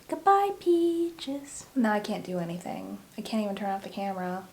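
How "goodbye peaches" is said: high, very slow, soft cooing farewell; drawn-out sweet goodbye to the puppy. Real, not acted.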